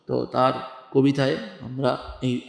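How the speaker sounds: ordinary talk, nothing marked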